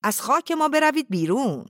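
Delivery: loud, high-pitched shouted order; angry shout. A narrator's reading. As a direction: shout them out of your land. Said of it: "مختاروف داد زد". (فریاد بلند و خشمگین)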